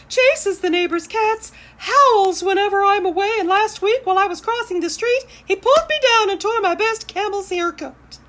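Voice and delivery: high, loud exasperated lady voice; shrill-pitched fussy lady, very loud; frantic Mrs. LaRue. Acted. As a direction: wail it out like a fretting owner at her wit's end. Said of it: "said Missus LaRue".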